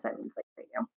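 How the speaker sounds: breathy whispered explanation to listeners; whispered aside. Real, not acted. Reader explains an old word to listeners, whispered aside.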